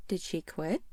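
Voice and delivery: ordinary delivery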